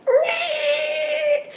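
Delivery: high, sung, whimpering meh; sung whiny meh. Retold or imitated. Miles dodging Zoe's question, sung high whine. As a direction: squeak it out like a sulky kid. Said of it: "he's like".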